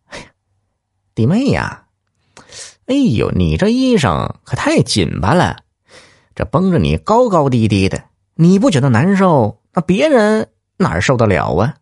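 lightly voiced sleazy teasing; leering grin. Village lout leers at a young woman, mild sleazy tease. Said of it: "嬉皮笑脸地说".

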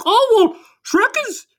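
very high, loud squealing pig; high-pitched pig impression; panicky pig voice.

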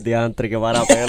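amazed words said through a real laugh. Real, not acted. Host laughing in awe at the guest's story. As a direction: laugh while you react.

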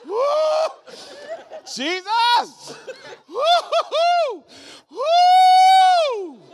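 high shouted woo; real celebratory shouts; joyful whoop. Real, not acted. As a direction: whoop with the congregation.

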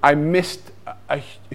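ordinary talk

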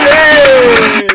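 a big, high, loud cheer; excited shout. Real, not acted. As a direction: cheer him on after the set. Hosts cheer after the clip.

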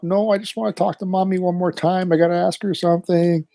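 put-on high, soft pleading kid voice; lightly voiced pleading son; stalling kid. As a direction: whine as if stalling. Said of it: "Just trying to drag it out".

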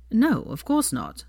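drawn-out, dismissive reassurance. A narrator's reading. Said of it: "he said dismissively".

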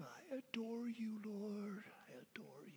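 hushed whisper to God; whispered adoration. Acted. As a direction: breathe it softly, intimately. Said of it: "whispered adoration".

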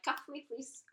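soft, playful begging; mock pleading. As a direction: quietly plead as a joke. Mock plea to be coupled.

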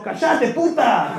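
slow drawn-out mock insult; a lightly acted snarl. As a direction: drag out the insult at her. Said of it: "le decimos".

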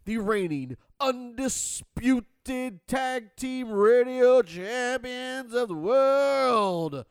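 booming ring-announcer intro, slow; grand entrance announcement. Acted. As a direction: stretch it out like a wrestling announcer. Radio show intro.

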